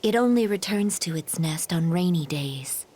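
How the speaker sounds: whispering. Acted. Character shares a secret.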